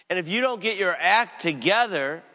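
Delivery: loud, rising warning on "don't". Acted. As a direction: thunder the warning, hit "don't". Jesus voiced rebuking his disciple.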